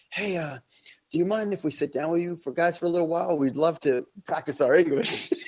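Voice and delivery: a light hammed-up friendly request; mock friendly soldiers. Soldiers asking the tourists, lightly mock friendly.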